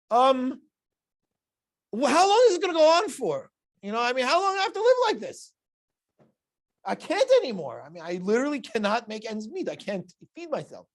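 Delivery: high, loud, desperate plea to God; pleading Elazar. Retold or imitated. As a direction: beg God for mercy, voice up high.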